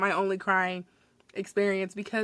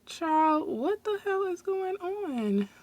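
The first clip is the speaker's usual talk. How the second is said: exasperated; 8 semitones higher, 4 dB quieter, much slower than usual. Retold or imitated. Exasperated retort to customer.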